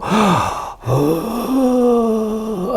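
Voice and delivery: sharp high gasp of a shocked crowd; audience gasp. Retold or imitated. Recalling a shocked theatre audience, a sharp high gasp. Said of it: "you hear the whole audience go".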